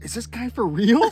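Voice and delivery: high, loud amazed aside; incredulous storytelling exclamation; retold disbelief. Retold amazed reaction to accent.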